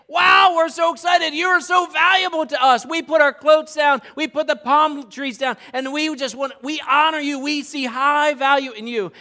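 loud, high mock cheer of an excited crowd. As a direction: shout it like a thrilled crowd praising a king. Crowd cheering Jesus, shouted.

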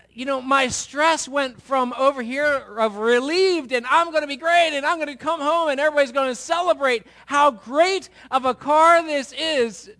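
overjoyed build-up he's about to lose; mock-triumphant gush; hammed-up excitement. Retelling his hopeful excitement.

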